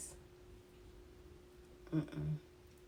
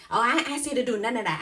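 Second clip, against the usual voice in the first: louder, higher, fast mock snap; indignant retort; 5 semitones higher, 8 dB louder, faster than usual. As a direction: throw it back in their face. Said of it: "be like".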